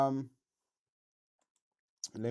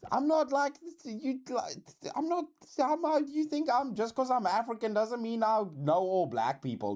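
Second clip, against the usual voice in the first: very high, stammering objection; high-pitched, indignant self-defence; flustered protest; 12 semitones higher than usual. Real, not acted. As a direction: squeak out a defence, restarting as you go.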